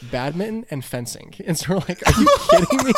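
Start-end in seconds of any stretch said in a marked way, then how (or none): 2.1-2.9 high, loud incredulous cry; incredulous outburst. Retold or imitated. Missionary reacting to the president's posh hobbies, incredulous outburst.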